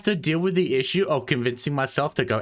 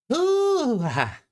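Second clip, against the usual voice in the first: high squeal of sourness; sour gasp; 14 semitones higher than usual. Real, not acted. Tasting sour drink.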